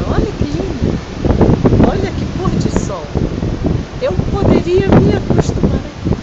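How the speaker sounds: high, thrilled about the view; excited. Real, not acted. Admiring sunset view.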